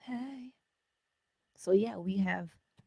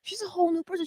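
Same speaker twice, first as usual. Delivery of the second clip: much higher, stress on whole; high delighted repeat; 13 semitones higher, 3 dB louder, much faster than usual. Real, not acted.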